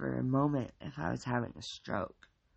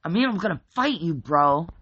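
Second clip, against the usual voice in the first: loud, fast macho bluster; loud fast bro bluster; 5 semitones higher, 13 dB louder than usual. A narrator's reading. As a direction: rush it out trying to sound tough. Mike blustering.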